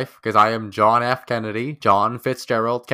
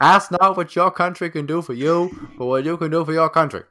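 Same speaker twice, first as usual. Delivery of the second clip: louder, higher Kennedy-style Boston orator; JFK impression; 5 semitones higher, 3 dB louder than usual. Friends taking turns, a loud Kennedy Boston speech. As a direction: declaim it like Kennedy at the podium. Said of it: "one more J F K impression".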